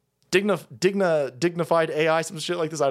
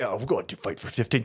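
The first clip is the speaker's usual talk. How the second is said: much lower, quieter, fast mocking voice; mock activist; 10 semitones lower, 5 dB quieter, faster than usual. Host mocking minimum wage activists, low and fast. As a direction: sneer it as a dopey activist. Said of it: "these are the same fuckers like".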